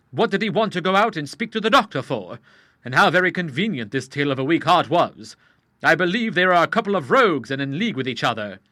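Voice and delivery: put-on a suspicious young man speaking out; Charles. A narrator's reading. Charles to the Admiral in the street, lightly voiced and uneasy.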